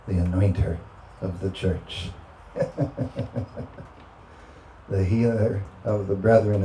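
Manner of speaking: low, soft healing prayer with long pauses; hushed prayer. Real, not acted. Preacher inviting healing over the congregation, hushed prayer. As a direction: pray gently, leaving space after each phrase.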